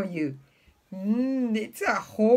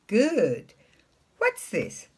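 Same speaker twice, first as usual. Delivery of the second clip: ordinary talk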